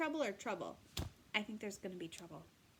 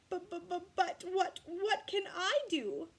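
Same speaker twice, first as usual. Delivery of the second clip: high stammering tearful voice; crying crocodile; 8 semitones higher, 5 dB louder than usual. Acted. Crocodile stammering through tears to Katy. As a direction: stammer out the words as if weeping. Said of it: "The crocodile began to cry too".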